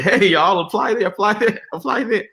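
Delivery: high-pitched, playful rallying call; mock eager urging. Telling colleagues around the country to apply, playful urging.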